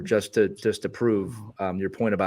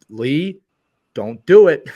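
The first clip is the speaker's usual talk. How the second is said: stern warning to self; 3 semitones higher, 9 dB louder, much slower than usual. Retold or imitated. Telling himself to stay quiet.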